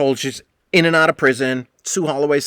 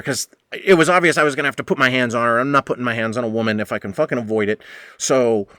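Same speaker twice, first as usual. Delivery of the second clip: ordinary talk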